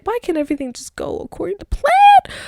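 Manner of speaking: high, whiny complaint at the world; mock exasperation. Acting out own frustration.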